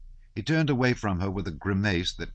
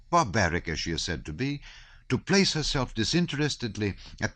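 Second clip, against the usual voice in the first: earnest abbé; 6 semitones higher than usual. Acted. Abbé lecturing Pierre on politics, raised and earnest. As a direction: lecture the room on his pet cause. Abbé expounding his theory.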